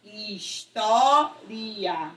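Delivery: História split very slowly into its syllables; very slow syllabified. Shown as a demonstration. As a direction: break História apart to show the hiatus. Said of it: "esse iria é um hiato". (História separada bem lentamente em sílabas)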